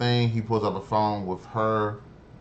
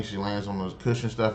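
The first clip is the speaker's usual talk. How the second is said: normal talking voice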